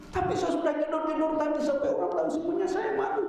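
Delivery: high, quick wife's voice; wife tells her husband she is ashamed, high and fast; wife complaining. Retold or imitated.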